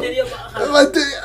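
high, slow laughing words; half crying with laughter. Real, not acted. Laughing while telling.

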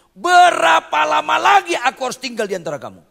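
high, loud furious shout; great anger. Acted. Jesus shouting at the faithless crowd, furious loud outcry. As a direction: bellow it in anger. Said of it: "Itu marah besar".

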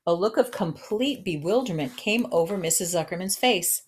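ordinary delivery, nothing marked

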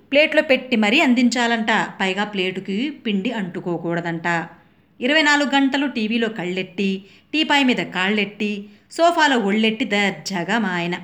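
plain reading voice

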